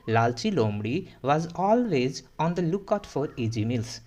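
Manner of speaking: slow, each English word spelled out in sound; slow model reading. Shown as a demonstration. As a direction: model the sentence slowly for learners. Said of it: "तो कैसे pronunciate करोगे". (धीमे-धीमे पढ़कर दिखाना)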